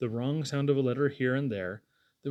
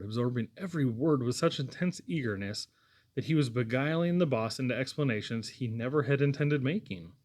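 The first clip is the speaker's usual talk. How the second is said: ordinary delivery, nothing marked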